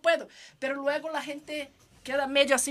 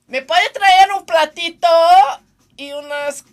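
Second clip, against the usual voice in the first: calling out; 4 semitones higher, 12 dB louder than usual. Real, not acted. Asking crew outside again.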